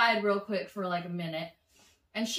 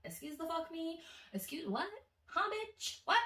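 incredulous; 7 semitones higher, 8 dB quieter than usual. Retold or imitated. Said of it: "In my head, this is what I said".

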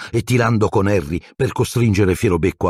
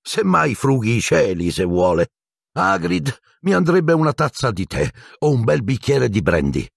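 lightly amused, higher-pitched lilt; 8 semitones higher than usual. A narrator's reading. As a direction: toss it off with light, lilting amusement. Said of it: "ancora più divertito". (leggero e divertito)

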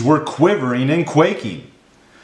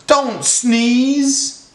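high-pitched plea, drawn out; high, anxious fish begging; pleading fish; 12 semitones higher than usual. A narrator's reading. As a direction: beg in a high little fish voice. Fish begs elephant not to sneeze.

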